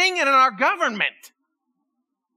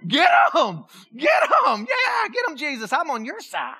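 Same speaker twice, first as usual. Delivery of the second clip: cheering fan; 3 semitones higher, 3 dB louder than usual. Pretend. Pastor imagining himself cheering Jesus on in the temple, loud and excited. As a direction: whoop like a fan in the stands. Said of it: "I'd have been the one that's like".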